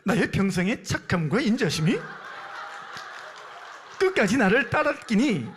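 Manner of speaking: forceful North Korean dialect; 북한 사투리. Shown as a demonstration. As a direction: deliver it as a loud North Korean speaker. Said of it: "마지막 북한 버전입니다". (힘주어 말하는 북한 사투리)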